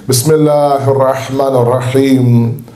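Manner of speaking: ordinary delivery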